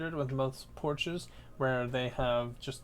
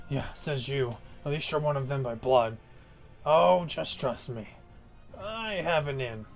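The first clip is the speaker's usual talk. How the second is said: brutish orc voice; 4 dB louder than usual. Acted. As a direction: grumble like a sly orc.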